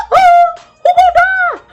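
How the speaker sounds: very high, drawn-out squeal; stretched, piercingly high comic question; mock alarm. Mock reaction to the threat.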